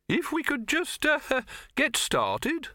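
very high, slow fretful voice; nervous Silverfish. Acted. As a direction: plead timidly to begin.

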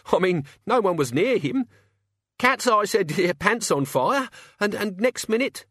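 very high, frightened protest; alarmed, high-pitched henchman; panicked denial. Acted. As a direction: squeak out that you're innocent. Chicken Wire in panic.

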